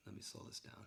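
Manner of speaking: breathy whisper to himself; quiet murmured aside. Real, not acted. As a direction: whisper it while working the controls. Aside while slowing the video.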